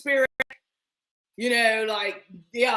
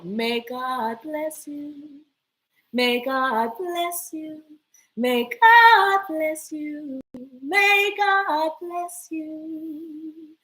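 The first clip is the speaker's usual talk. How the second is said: slow blessing; much slower than usual. Acted. Drawn-out blessing.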